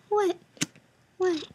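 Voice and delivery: usual speaking voice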